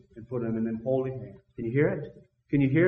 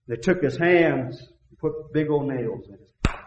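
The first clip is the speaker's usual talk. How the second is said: ordinary talk, nothing marked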